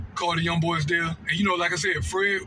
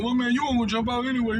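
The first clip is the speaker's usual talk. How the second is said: imagined mocking viewer; 5 semitones higher than usual. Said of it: "And you probably thinking".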